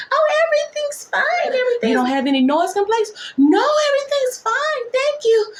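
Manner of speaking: very high sing-song everything's fine; mock sweet patient. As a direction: cover up in a chirpy high voice.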